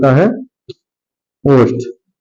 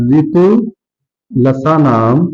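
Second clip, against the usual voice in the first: slow recitation; 4 semitones lower, slower than usual. Shown as a demonstration. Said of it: "teacher recites sutra slowly".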